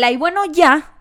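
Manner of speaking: hammed-up dismissive shrug; mock dismissive. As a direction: toss it away with a big sigh-off. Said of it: "Y en el".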